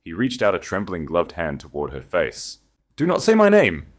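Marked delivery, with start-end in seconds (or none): 3.0-3.9 sharp, high, loud command; cutting shout. A narrator's reading. Eleanor cutting off the Duke, sharp shout. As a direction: slice him off with a cry. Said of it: "Her words sliced through".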